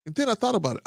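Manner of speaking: normal talking voice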